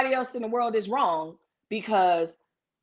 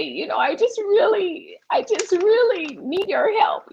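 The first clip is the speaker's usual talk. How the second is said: higher, slower whiny damsel voice; whiny helpless woman; 11 semitones higher than usual. Pretend. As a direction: put on a helpless little-woman whine to tease the husband. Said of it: "she has a voice and everything".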